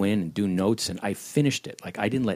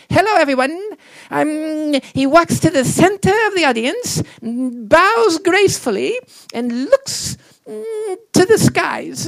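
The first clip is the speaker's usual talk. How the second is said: very high, loud squeaky Goons voice; Blue Bottle voice; 8 dB louder, slower than usual. Retold or imitated. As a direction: narrate your own stage directions in a squeaky cartoon voice. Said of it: "a character called Blue Bottle".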